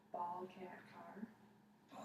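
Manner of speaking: barely voiced whisper, soft and breathy. Shown as a demonstration. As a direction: whisper each word softly for a hearing test. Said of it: "I'm gonna say three words".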